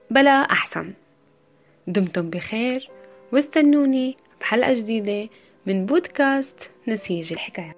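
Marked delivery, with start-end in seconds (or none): none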